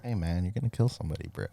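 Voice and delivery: low, soft half-hearted scold; mild scolding. Pretend. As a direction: gently tell him off, no bite. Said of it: "Not like that".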